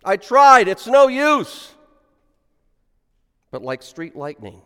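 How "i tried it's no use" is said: loud, high, startled yell; startled shout. Acted. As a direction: cry out in sudden fright. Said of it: "startled, said".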